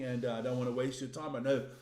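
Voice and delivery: ordinary talk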